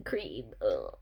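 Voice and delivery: low, soft, disgusted mutter. Real, not acted. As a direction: recoil from the word in real disgust. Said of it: "it's so gross".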